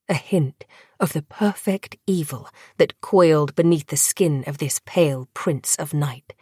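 plain reading voice

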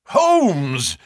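high, startled cry of a name; a cry of recognition, falling at the end. A narrator's reading. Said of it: "I cried".

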